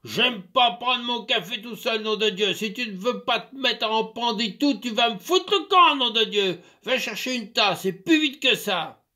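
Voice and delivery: loud, high furious bellowing; angry outburst. Acted. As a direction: explode with rage. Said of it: "il éclata de nouveau".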